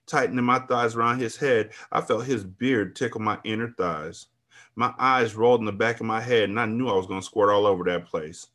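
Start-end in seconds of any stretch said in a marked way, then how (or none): none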